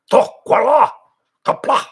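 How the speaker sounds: "Tokwala Kapla" barked loud, high and harsh; speaking Klingon. Shown as a demonstration. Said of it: "Speak Klingon".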